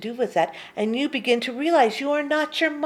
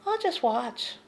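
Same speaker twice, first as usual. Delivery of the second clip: ordinary talk, nothing marked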